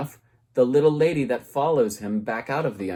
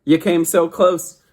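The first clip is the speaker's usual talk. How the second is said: louder and higher scolding; the teacher scolds Orpheus, louder and higher; exasperated; 6 semitones higher, 8 dB louder than usual. Acted. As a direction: tell him off with emphasis on blew.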